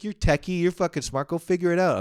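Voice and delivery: high, loud urging; insistent girlfriend. Retold or imitated. Girlfriend urging techie boyfriend to fix vacuum, insistent. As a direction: push him with exasperated encouragement.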